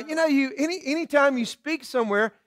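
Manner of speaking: normal talking voice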